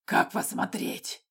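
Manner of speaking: slow, breathy croak; gurgling raven voice. A narrator's reading. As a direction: rasp it like a raven. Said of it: "всё тем же клокочущим голосом".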